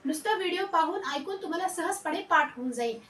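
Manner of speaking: ordinary talk, nothing marked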